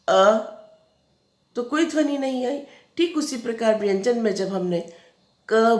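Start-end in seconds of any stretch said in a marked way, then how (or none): 0.1-0.5 अ said alone, loud and clear; demonstrating a letter sound. Shown as a demonstration. Teacher sounding out अ for children, loud and clear. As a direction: sound out अ for the class. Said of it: "अगर हम व्यंजन बोलते हैं".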